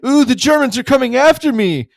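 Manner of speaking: loud, high, sarcastic fake fear; mock-scared Burns. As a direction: ham up being frightened to mock them. Burns keeps mocking.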